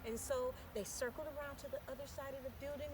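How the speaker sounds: plain reading voice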